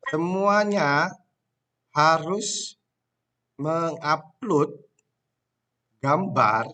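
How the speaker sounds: very slow, spaced dictation. Shown as a demonstration. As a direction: dictate each word with long pauses. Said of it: "teacher dictating the assignment".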